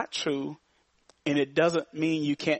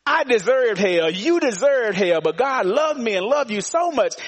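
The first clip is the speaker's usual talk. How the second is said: higher and louder, rising preacher's cadence; preaching at full force; 6 semitones higher, 3 dB louder than usual. Acted. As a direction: preach it to the back row.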